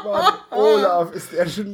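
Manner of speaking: laughing while complaining; slow laughing groan, then a yell. Real, not acted. Groaning at bad pun.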